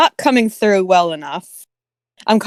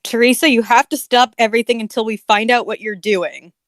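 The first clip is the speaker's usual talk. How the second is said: usual speaking voice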